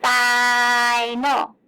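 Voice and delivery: final with a long loud I; held-out syllables. Shown as a demonstration. Teacher holding out the I again for kids. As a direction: stretch the I in final, fiii-nal. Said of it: "I was holding out that I sound".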